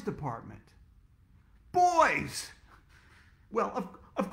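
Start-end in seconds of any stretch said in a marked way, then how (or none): 1.7-2.5 high loud startled cry; surprised exclamation. Acted. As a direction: blurt it out in shock. Shocked discovery in the store.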